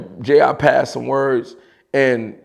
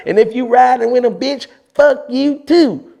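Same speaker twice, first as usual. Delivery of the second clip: imitating JR on tape; 9 semitones higher than usual. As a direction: stretch the last words in a high mock JR voice. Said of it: "I actually do it on tape, exactly how he said it".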